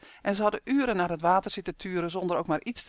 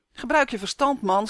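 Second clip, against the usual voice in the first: raised, louder voice with higher pitch; narrated shout, pitched up; shouting in alarm; 5 semitones higher, 5 dB louder than usual. A narrator's reading. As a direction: shout it at a friend, storybook style. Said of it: "schreeuwde Tom".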